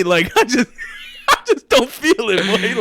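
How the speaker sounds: high, loud words broken by laughter; can barely get it out for laughing; giggling, voice pushed up high; laughing through it. Real, not acted. Laughing while telling the story.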